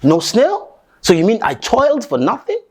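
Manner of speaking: high, incredulous outcry; a father's shocked high protest at the wasted day; stunned disbelief. Acted. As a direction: cannot believe his ears. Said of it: "the father looks at him like".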